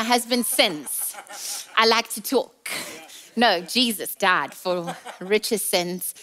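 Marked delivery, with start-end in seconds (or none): none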